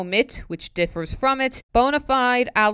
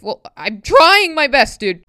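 exasperated; 5 semitones higher, 8 dB louder than usual. Real, not acted. Stumbling over tricky poem words.

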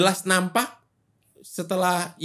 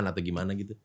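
normal talking voice

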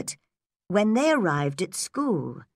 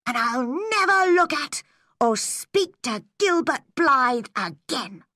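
wounded schoolgirl vowing with rising pitch; angry vow; 7 semitones higher than usual. Acted. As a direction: swear it bitterly, word by word. Angry girl vows to herself.

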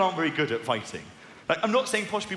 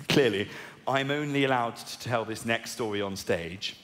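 ordinary delivery

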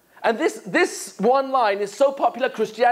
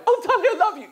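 higher, doting wife gushing; adoring wife; 11 semitones higher, much faster than usual. Acted. Wife thanks her husband on the phone, higher and doting. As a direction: coo it like a delighted wife.

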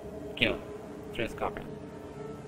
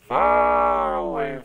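drawn out; 8 dB louder, much slower than usual. Real, not acted. Gamer fleeing from ghouls.

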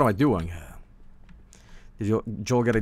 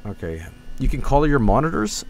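normal talking voice

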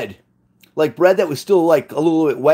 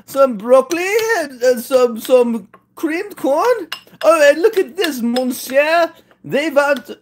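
much higher hammed-up vague European accent as an Italian parent; mock foreign accent; 10 semitones higher, slower than usual. Imitating Italian parents feeding the new baby, silly high mock accent. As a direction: play a silly made-up accent, pitched way up. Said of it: "what kind of accent is this?".

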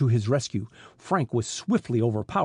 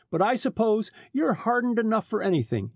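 understated bitterness, pitched higher; bitter; 9 semitones higher than usual. A narrator's reading. Bound detective to the smuggler chief, mildly bitter. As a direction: add it with a touch of reproach. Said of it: "he added bitterly".

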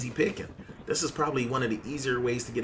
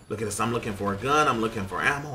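normal talking voice

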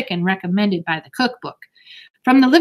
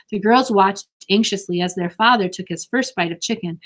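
plain reading voice

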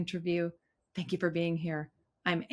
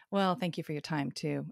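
ordinary delivery

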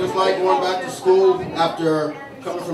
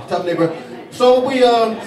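ordinary talk, nothing marked

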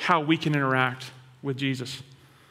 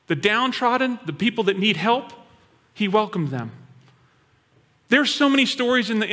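normal talking voice

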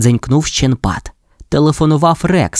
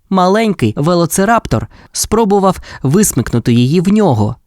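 usual speaking voice

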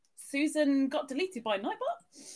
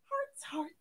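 whispered hearts; 8 dB quieter than usual. Pretend. Streamer whispering hearts at the end of the chant.

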